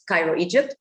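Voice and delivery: normal talking voice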